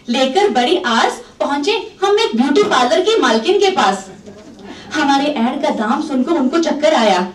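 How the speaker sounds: ordinary delivery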